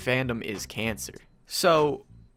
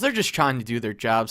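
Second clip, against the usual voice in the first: ordinary delivery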